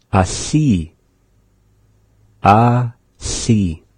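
"Asi" with the lateral LH sound in the middle; teacher modelling "Asi" slowly; pronounce asi. Shown as a demonstration. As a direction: repeat "Asi", blowing air past the side of the tongue. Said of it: "our practice is for the ... L H".

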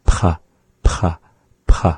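"P" released with a raspy throat breath; breathy back P. Shown as a demonstration. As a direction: push breath from the back after each "P". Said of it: "breath with it from the back".

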